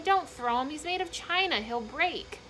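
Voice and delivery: high, urgent plea; crying out. A narrator's reading. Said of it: "cried Abilene".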